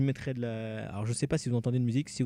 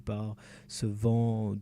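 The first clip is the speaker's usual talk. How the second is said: slow dreamy reading; much slower than usual. Acted. Dreamy poetic reading of game text.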